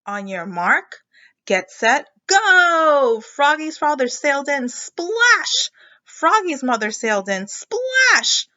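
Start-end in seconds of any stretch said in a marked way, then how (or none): 0.1-3.2 rising to a loud shout of go; starting call. Acted. Race start call in story. 4.7-5.7 high, loud splash; dramatic splash. A narrator's reading. Sound word read aloud. 7.5-8.5 high, loud splash; dramatic splash. A narrator's reading. Sound word read aloud.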